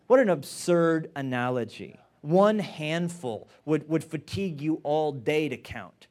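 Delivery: usual speaking voice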